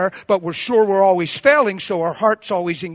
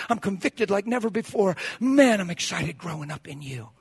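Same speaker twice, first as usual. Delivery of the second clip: grateful prayer; 4 dB quieter than usual. Shown as a demonstration. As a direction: quietly thank God, settled and assured. Models thankful morning prayer.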